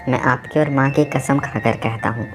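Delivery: usual speaking voice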